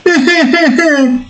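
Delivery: high, put-on character cackle; Oscar laugh. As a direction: chuckle in a very high cartoon voice. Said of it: "it's supposed to sound like Oscar".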